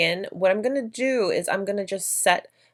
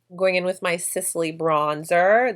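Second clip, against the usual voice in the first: ordinary talk, nothing marked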